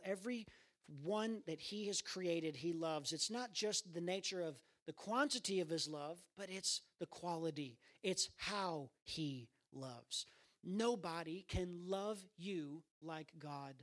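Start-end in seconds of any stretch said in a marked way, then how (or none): none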